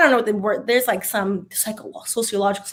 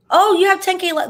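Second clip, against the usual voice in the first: excited encouragement; 9 semitones higher, 4 dB louder than usual. Real, not acted. Cheering a viewer's progress.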